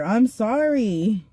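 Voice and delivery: slow, drawn-out, whiny sorry; exaggerated meek apology; mock submissive apology. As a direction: grovel like a belly-up dog. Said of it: "a dog with their belly up".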